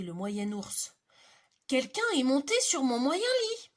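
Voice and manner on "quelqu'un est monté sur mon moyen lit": higher, much louder medium bear; indignant middle bear. Acted. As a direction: middle bear finding her bed climbed on.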